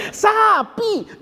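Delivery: high, forceful "sapi" with no m; teacher's insistent correction. Retold or imitated. Teacher correcting the pupil's sampi, high insistent "sapi" without m. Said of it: "kata gurunya".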